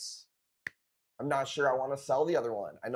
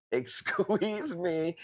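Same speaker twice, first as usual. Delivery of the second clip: very slow, higher mock-offended query; 6 semitones higher, much slower than usual.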